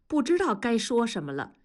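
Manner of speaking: usual speaking voice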